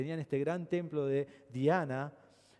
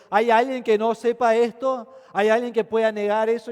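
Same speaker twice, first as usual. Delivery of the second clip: city clerk's challenge; 5 semitones higher, 11 dB louder than usual. Retold or imitated. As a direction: dare the crowd to deny it. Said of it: "Y él dice".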